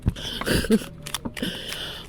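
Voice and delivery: breathy evil snicker; machiavellian laugh. Pretend. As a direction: snicker like a villain. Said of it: "j'ai un rire machiavélique".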